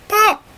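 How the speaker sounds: a sudden very high pop; high pop sound. Acted. Turnip bursting out of the ground, sudden high pop. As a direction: pop it out like a cork.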